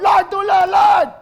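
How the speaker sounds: high, loud desperate shout; shouting for help. Acted. Believer in a storm crying out to the Lord, high desperate shout. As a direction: scream to the Lord for rescue. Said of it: "sumisigaw ka sa Lord".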